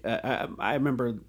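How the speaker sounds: ordinary talk, nothing marked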